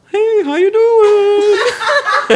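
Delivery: very high, slow sing-song coworker greeting; mimicking Anna. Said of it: "she'd be like".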